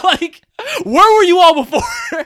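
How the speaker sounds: high, loud laughing complaint. Real, not acted.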